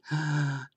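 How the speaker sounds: sharp excited intake of breath; delighted gasp. Retold or imitated. Mother about to see child's drawing, thrilled gasp. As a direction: gasp in acted wonder.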